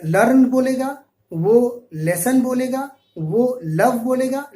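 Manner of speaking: लर्न, लेसन and लव said slowly with a light thin L; slow mock of a weak L in लर्न; thin wrong L. Shown as a demonstration. Teacher mimicking learners who say the L thinly. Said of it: "जैसे कि एक बंदा एल पढ़ता है".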